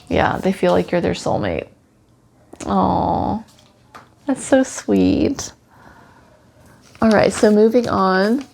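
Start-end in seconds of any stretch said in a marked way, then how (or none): 2.7-5.5 tender aw. Real, not acted. Cooing over the dog's devotion.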